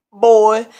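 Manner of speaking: loud exclaimed retort; mock shout. Burglar reacting to a kid's bragging, mock shout. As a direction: belt it out in mock disbelief.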